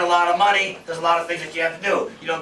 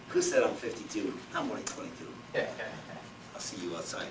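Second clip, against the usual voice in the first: half-whispered joke; 10 semitones lower, 15 dB quieter than usual. Real, not acted. Joking aside about age.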